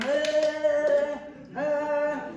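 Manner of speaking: hammed-up high, loud sing-song ah, ah; silly sung ah. Mel Gibson clowning to calm a nervous interviewer, loud sung ahs. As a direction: make a complete fool of yourself on purpose. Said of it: "he started going".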